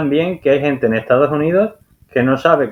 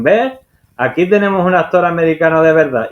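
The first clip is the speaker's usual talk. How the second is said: higher and louder, a gleeful mock gotcha; imitating delighted casting producers; retold triumphant exclamation; 6 semitones higher, 3 dB louder than usual. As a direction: play the producers crowing over their find. Producers triumphantly hiring Hugh Laurie.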